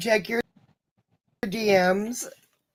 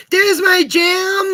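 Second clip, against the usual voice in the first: slow, high happy shout; excited greeting; 10 semitones higher, 4 dB louder, slower than usual. Real, not acted. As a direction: call out happily to a friend. Greeting a friend arriving.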